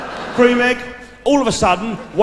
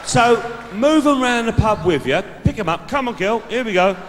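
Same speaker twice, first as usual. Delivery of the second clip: hearty call; 6 semitones higher than usual. Pretend. Steering the date round pub.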